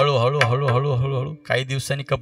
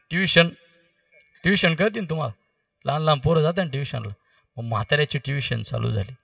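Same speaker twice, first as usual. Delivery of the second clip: ordinary talk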